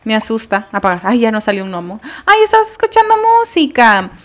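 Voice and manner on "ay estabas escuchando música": surprised high. Real, not acted. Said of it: "perdóname".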